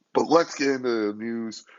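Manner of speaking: usual speaking voice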